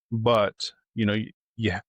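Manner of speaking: usual speaking voice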